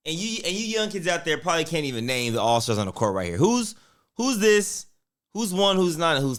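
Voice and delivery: loud, high quiz-show calling out; excited shouting. Real, not acted. Quizzing viewers, calling out loudly.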